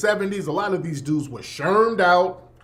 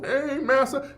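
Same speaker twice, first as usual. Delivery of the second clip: higher put-on minstrel voice; a quick jab of the Step and Fetch It act; mock minstrel greeting; 8 semitones higher than usual. Mocking the old minstrel coon act, higher put-on voice. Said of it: "Even Step and Fetch It".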